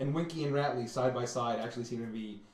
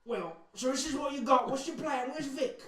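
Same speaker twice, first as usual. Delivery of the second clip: much higher lizard chatter; high lizard-folk voice; 10 semitones higher than usual. Acted. Lizard folk asking the party their plan, high. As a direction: lizards sizing up the newcomers.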